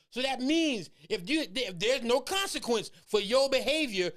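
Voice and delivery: ordinary talk, nothing marked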